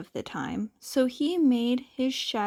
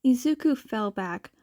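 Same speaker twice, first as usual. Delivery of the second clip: ordinary delivery, nothing marked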